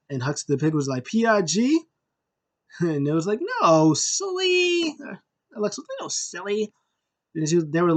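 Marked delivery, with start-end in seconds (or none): 1.0-1.8 lightly voiced high puppet; a hint of a questioning pig puppet. Huxley the Pig guessing the letters, lightly voiced puppet. 3.6-4.9 very high, drawn-out puppet-show voice; playful mock scolding. Puppet show host correcting the pig, high playful scolding. 6.0-6.7 high, softer imitation; lighter playful correction. Alexa correcting the pig, light high imitation.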